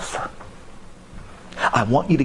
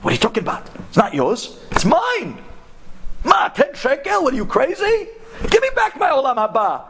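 worked up; 12 semitones higher than usual. Retold or imitated. Taxi driver protests to the Kollel guy, worked up and disbelieving. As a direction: sputter in disbelief, voice climbing. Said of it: "The Nahag starts getting all worked up".

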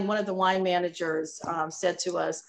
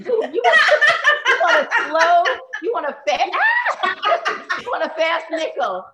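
higher, loud, breaking into laughter; laughing through quote; 10 semitones higher, 6 dB louder than usual. Retold or imitated. Retelling the manager's advice, laughing helplessly. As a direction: try to repeat the line but keep cracking up.

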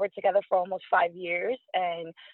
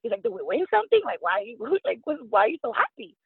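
surprised; 6 semitones higher than usual. Retold or imitated. Boyfriend confused by her excitement.

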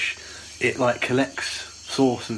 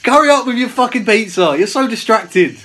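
mock impatient shout; 10 semitones higher, 8 dB louder than usual. Mock scolding pizza eater.

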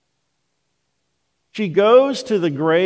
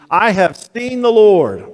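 higher, louder joyful proclamation; ringing, raised-voice declaration; exultant announcement; 6 semitones higher, 6 dB louder than usual. Acted. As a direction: proclaim the news with rising excitement. Mary announces the news to disciples.

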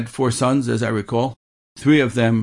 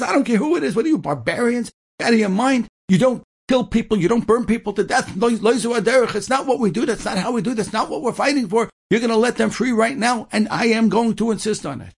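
high-pitched, insistent officer's rant; sustained high, indignant retold yelling; retold screaming tirade; 11 semitones higher than usual. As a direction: scold and command, acting out his outrage. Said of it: "He starts screaming".

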